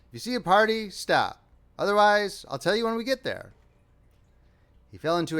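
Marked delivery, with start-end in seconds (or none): 0.1-3.5 mostly higher, assured instructions; confident direction. A narrator's reading. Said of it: "directed Perry with fine confidence".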